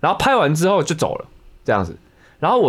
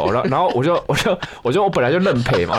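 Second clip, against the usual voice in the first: ordinary delivery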